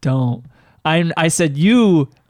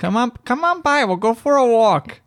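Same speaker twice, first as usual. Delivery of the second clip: imitated Wisconsin accent; 7 semitones higher than usual. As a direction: mimic a Wisconsin accent, pitched way up. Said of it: "Is that the Wisconsin accent".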